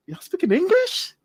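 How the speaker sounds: high, laughing disbelief; incredulous question. Retold or imitated. Telling a friend about New Jersey speech, high laughing disbelief. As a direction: act baffled at hearing New Jersey speech. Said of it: "I'm like".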